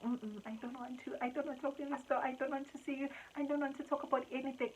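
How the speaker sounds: tearful refusal. Retold or imitated. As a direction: plead tearfully to be left alone. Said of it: "I started crying".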